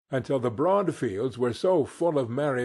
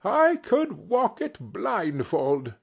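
fervent; 8 semitones higher, slower than usual. A narrator's reading. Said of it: "cried Scrooge with fervour".

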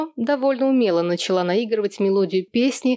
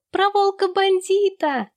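put-on very high, drawn-out child voice; chorus it like excited kids; slow high-pitched children's cry; 12 semitones higher than usual. A narrator's reading. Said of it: "закричали дети".

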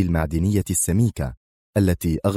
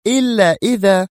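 intense; 12 semitones higher, 4 dB louder than usual. A narrator's reading. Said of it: "وهي تكمل".

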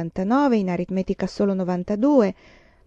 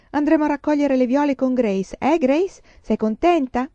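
put-on high, eager girlish chatter; bubbly, raised pitch with lilting questions; delighted exclamation; 7 semitones higher, 3 dB louder than usual. A narrator's reading. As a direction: coax the little one to share the excitement. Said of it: "esclamò Carrie".

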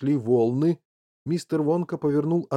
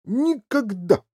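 high, raised-voice father's outburst; shouted refusal; 10 semitones higher, 4 dB louder, slower than usual. A narrator's reading. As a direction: forbid it with a yell. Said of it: "закричал мистер Ти Ви".

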